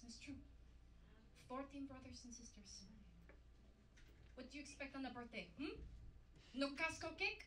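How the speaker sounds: ordinary delivery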